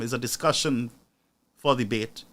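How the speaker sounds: ordinary talk, nothing marked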